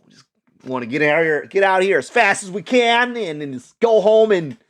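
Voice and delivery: ordinary talk, nothing marked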